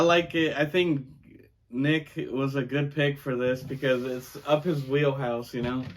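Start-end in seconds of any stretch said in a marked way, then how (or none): none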